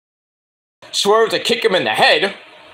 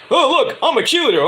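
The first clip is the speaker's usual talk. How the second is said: higher, sing-song mock; mocking cheerleader voice; 8 semitones higher than usual. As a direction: tease like a sarcastic cheerleader. Sting mocking with pom-poms.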